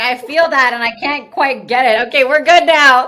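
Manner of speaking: loud, high, giggling through words; laughing. Real, not acted. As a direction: laugh while fishing out a hair. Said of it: "I love these real moments".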